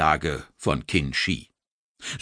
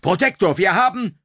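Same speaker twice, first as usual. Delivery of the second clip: high, loud urgent report; urgent call; 12 semitones higher, 4 dB louder than usual. Acted. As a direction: shout up to the commander, cut off. Officer calls urgently, interrupted.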